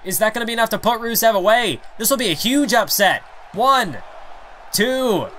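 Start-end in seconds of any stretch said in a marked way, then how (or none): 3.5-5.3 rising, urgent near-fall count; excited count. Acted. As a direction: count it like the upset is coming. Near-fall count, crowd building.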